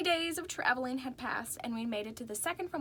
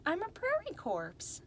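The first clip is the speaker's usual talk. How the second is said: condescending; 8 semitones higher than usual. A narrator's reading. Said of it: "like they were all stupid".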